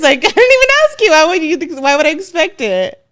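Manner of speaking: laughing high and loud in protest. Real, not acted. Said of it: "laughing protest".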